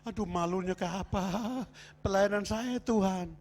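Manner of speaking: ratapan doa yang diperagakan; pura-pura merengek malu kepada Tuhan; menirukan tangis. Retold or imitated. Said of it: "mulai nangis". (menirukan orang mengadu sambil menangis)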